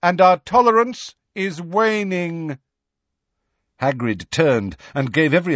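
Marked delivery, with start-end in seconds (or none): 0.0-2.6 high, projected warning; calling after. A narrator's reading. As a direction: call out the threat across a distance. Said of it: "Megorian called after them".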